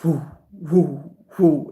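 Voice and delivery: repeated breathy "whoo"; whooshing out an overwhelming energy; imitated whoosh of energy. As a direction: puff out short whoos, one by one. Imitates rush of intense energy.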